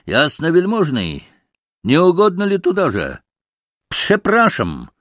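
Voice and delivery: high, ceremonious invitation; mock-polite exclamation. A narrator's reading. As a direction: invite him out with exaggerated courtesy. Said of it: "воскликнул к маленькому пану".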